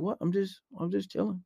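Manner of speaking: very soft, unbothered reply; nonchalant. Retold or imitated. Randy to his alarmed friends, nonchalant and quiet. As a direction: shrug it off under the breath. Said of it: "It's like nothing bothers that".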